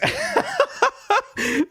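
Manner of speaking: high peal of laughter; real laughter. Real, not acted. Host cracking up at the worst plug ever, high laughter. Laughing at own mock slogan.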